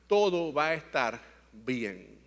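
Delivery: slow, low and reassuring; retold reassurance. Father reassures his repentant son, slow calm reassurance. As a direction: settle him down gently, word by word. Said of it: "yo estaba afirmándolo".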